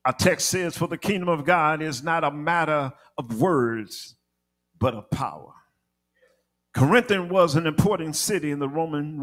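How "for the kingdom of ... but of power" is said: measured, lower-pitched delivery with weighty pauses; slowed-down scripture reading. Real, not acted. Preacher reading the text to church, slowed down and measured. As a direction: settle down and give the verse room. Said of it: "Let me slow down".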